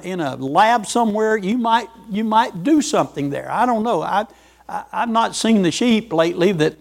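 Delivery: ordinary delivery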